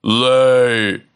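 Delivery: loud barked command; giant's shout. Acted. Giant commands his hen, loud. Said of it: "He shouted".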